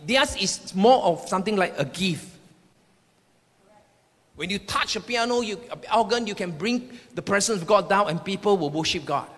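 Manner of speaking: ordinary talk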